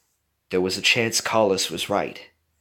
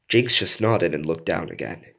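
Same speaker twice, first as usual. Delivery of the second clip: ordinary delivery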